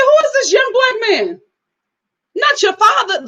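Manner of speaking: high-pitched incredulous protest; mock disbelief. Reacting to a surprisingly young father, incredulous. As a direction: exclaim it high, as if utterly thrown.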